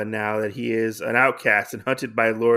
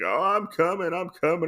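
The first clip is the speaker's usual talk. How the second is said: Mashiro calling back; 7 semitones higher than usual. Retold or imitated. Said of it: "He's like".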